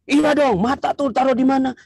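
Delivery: high, snippy husband voice; husband scolding his wife for the mistake. Acted.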